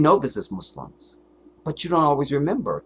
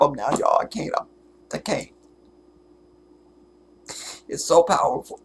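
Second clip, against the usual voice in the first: tearful; 3 semitones higher than usual. Real, not acted. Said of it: "I can't stop 'em now".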